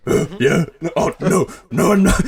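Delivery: gruff caveman-like rasp, flustered; comically hoarse, caught-out superhero; Batman. Acted. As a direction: stammer a denial in a strained disguised growl. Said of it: "Why are you a caveman?".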